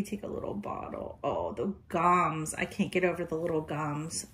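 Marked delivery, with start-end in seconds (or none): none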